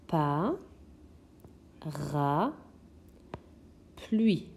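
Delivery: "Pa, ra, pluie" in isolated syllables with long pauses; découpage syllabique. Shown as a demonstration. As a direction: stretch and lift "Pa" and "ra", then drop on "pluie". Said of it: "On entend la syllabe ra ici". (« Pa, ra, pluie » syllabe par syllabe, bien séparées)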